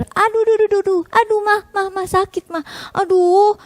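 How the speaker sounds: high mock yelps of pain. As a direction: cry out as your ear is pulled. Child's ear being pulled. (anak mengaduh kesakitan)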